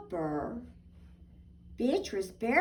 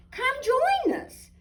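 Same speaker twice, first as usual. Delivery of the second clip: high, loud cheerful invitation; a friend calling from the ice; Millie inviting; 14 semitones higher, 5 dB louder than usual. Acted. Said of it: "said Millie".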